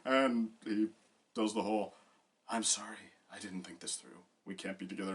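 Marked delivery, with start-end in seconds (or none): 2.5-5.1 very soft, low-key breakup line; subdued apology. Retold or imitated. Calling off the fairy marriage in a retold scene, softly apologetic. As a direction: let it sink almost to nothing.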